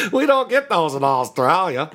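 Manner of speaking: high mock Australian through laughter; hammed-up Aussie with a laugh; Australian accent. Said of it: "One of those dudes from Australia".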